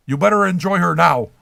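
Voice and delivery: normal talking voice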